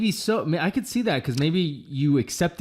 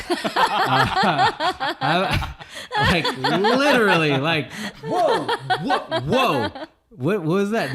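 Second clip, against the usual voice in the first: laughing; 12 semitones higher, 5 dB louder, slower than usual. Real, not acted. Laughing at thrown objects.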